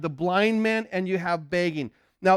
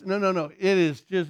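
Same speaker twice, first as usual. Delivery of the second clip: normal talking voice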